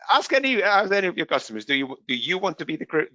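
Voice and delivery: ordinary delivery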